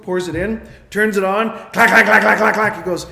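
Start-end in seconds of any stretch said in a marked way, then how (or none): 1.7-2.8 loud rattling clacks in quick succession; imitated engine clacking. Mechanic turns the engine on, imitated loud clacking. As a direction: mimic a ruined engine knocking hard.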